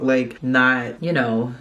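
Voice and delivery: ordinary talk, nothing marked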